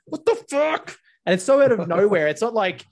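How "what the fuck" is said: mock stung yelp. Retelling a wasp sting to mates, startled high yell.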